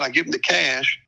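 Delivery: usual speaking voice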